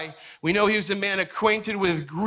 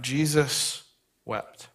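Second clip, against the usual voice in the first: solemn hush; 4 semitones lower, much slower than usual. Acted. Pastor reading the verse to the church, hushed and slow. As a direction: read "Jesus wept." like a held breath at a graveside. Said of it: "See, Jesus wept".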